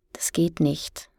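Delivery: soft, unvoiced murmur. A narrator's reading. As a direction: murmur it, barely audible. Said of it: "murmelte Luan".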